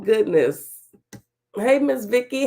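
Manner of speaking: normal talking voice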